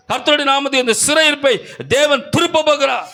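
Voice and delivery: building, high-pitched preaching cry; full-force revival sermon; fervent preaching. Real, not acted. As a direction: drive the promise home, rising in pitch.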